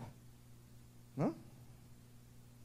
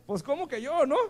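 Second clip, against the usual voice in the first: much higher and louder, unbelieving; incredulous Moses; 11 semitones higher, 6 dB louder than usual. Acted. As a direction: play Moses doubting he could be the one. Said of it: "así como que dijo". (Moisés incrédulo, más agudo y fuerte)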